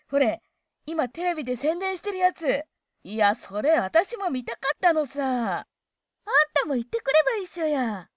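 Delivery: loud, lively Hokkaido-dialect gossip between two women; excited girlfriends' chat. Acted. Two girlfriends gushing over a film, excited and loud. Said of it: "女友達同士の会話".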